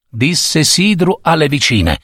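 ordinary delivery, nothing marked

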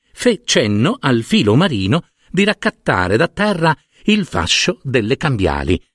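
ordinary delivery

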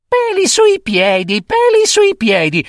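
very high mocking child voice with giggles; high sing-song teasing rhyme; teasing little girl. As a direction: taunt the monster cheekily. Girl's taunt.

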